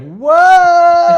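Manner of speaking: high, loud exclaimed whoa; hammed-up awestruck shout; Bailey reacting to Liv, high and loud; over-the-top whoa. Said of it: "you hear Bailey just go".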